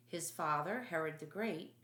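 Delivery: ordinary delivery, nothing marked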